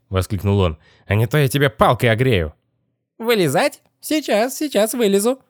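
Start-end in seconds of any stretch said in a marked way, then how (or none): 1.1-2.5 higher-pitched irritated threat; growing cross. A narrator's reading. Boss losing patience with the boy, growing cross. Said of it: "начинал сердиться". 3.2-5.4 very high squeaky mock obedience; nasty squeal. A narrator's reading. Possessed boy answering the angry boss, mocking squeal. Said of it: "мерзким визгливым голосом".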